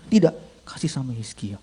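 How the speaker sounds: soft, low instruction; commanding inner voice. Acted. Inner voice directing envelope to the young man, soft and low. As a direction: give the order quietly and low. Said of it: "suara itu bilang begini".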